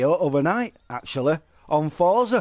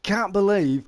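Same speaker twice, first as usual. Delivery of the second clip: ordinary talk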